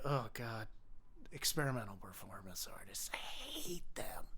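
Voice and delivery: a hushed, scornful aside about performance artists; mock whisper. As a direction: hiss it like a sneering confidant. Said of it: "It's more like a".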